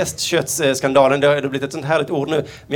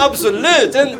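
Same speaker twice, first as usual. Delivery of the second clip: high disgusted cries; 8 semitones higher, much slower than usual. Acted. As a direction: cry out in laughing disgust. Mosquito recoiling.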